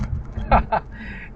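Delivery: short, high chuckle. Real, not acted. Driver laughing about bad drivers, short chuckle. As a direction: let out a quick laugh.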